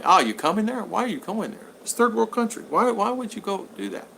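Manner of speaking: imitated mother. Mother questioning her son's move abroad, lightly imitated. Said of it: "she's telling me".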